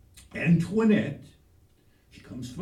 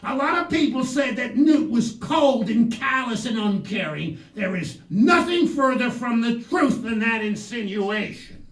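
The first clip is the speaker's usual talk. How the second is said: loud, raised oratorical protest; hammed-up righteous shouting; indignant defence; 5 semitones higher, 10 dB louder than usual. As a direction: denounce it like a stump speaker.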